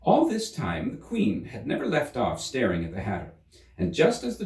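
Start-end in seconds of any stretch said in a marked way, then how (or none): none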